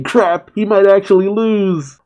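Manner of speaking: high-pitched mock panic; freaking out. Onlooker seeing the champion might lose, freaking out. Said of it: "Maki's freaking out, saying".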